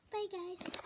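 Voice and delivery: a lightly voiced dog saying bye guys; voicing the dog. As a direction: lend the dog a small cute voice for bye guys. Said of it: "You heard what she said".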